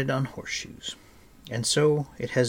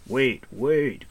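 urgent raised call to stop; louder, pressing warning; crying out; 3 semitones higher, 5 dB louder than usual. A narrator's reading. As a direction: call out loudly to halt someone. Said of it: "cried Cole".

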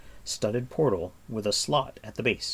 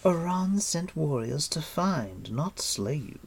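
smooth queen; 7 semitones higher than usual. Acted. Queen corrects the captive.

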